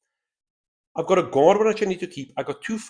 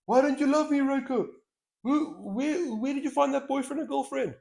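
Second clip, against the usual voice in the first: pleading character voice; 11 semitones higher than usual. Acted. Voicing pleading game character.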